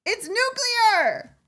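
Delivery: high, loud, slow wail of exasperation; frustrated, drawn-out high yell; mad. Real, not acted. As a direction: wail the correction, drawing it out. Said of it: "You just made me mad".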